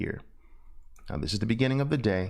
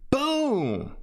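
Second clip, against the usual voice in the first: long cry of boom sliding high then falling; high drawn-out boom; 13 semitones higher than usual. Shown as a demonstration. Teacher making his favorite trade noise, long and high. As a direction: let the boom ring out like a cry. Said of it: "making and hearing that noise".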